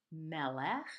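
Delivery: ordinary talk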